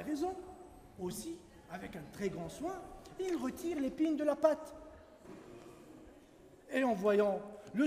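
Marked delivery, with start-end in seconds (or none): none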